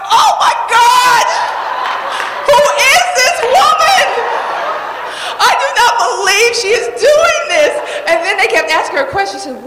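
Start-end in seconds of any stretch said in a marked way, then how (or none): 0.1-7.7 shocked laughing shout. Retold or imitated. Watching her sister flop on TV, shocked laughing shout. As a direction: cry out in shocked laughing disbelief.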